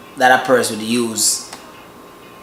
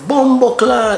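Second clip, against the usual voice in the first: high startled Bumbo clot; quick shocked Jamaican curse; shocked exclamation; 10 semitones higher, slower than usual. Retold or imitated. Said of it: "A man could be shocked and say".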